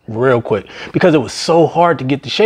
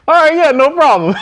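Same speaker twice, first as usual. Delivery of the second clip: much higher, bright agreeable voice; mock cheerful customer; 12 semitones higher, 3 dB louder than usual. Drive-through customer agreeing to come in, high mock cheerful. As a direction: play the customer happily agreeing, pitched way up.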